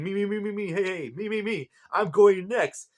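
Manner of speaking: put-on high, pleading kid voice cutting in; eager Anubis. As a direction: squeal for your turn like an impatient kid. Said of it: "Anubis speaking up".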